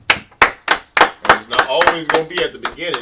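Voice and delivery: emphatic. Real, not acted. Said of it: "teacher stressing a point".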